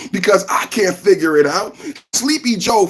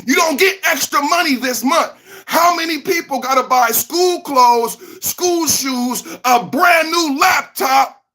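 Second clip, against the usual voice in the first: ranting shout; 6 semitones higher than usual. Real, not acted. Ranting at viewers about costs.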